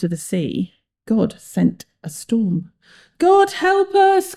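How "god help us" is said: high, drawn-out cry for help. A narrator's reading. Sailor calls to God in the storm, crying out. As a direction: wail it high and slow. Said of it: "cried a sailor".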